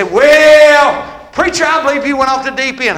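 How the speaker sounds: lightly voiced skeptic; preacher voicing a doubtful listener; doubting churchgoer. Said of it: "You say".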